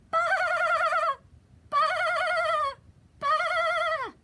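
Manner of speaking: loud high baa; high sheep bleat. Shown as a demonstration. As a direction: bleat like a little lamb. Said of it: "what do they say".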